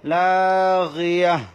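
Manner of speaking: slow, drawn-out melodic Quran chant; slow chanted Quranic Arabic for a tajweed class; Quran recitation. Shown as a demonstration. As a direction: recite it slowly with tajweed and stop on the last word. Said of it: "আবার বলতেছি আমি".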